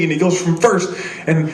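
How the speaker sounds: usual speaking voice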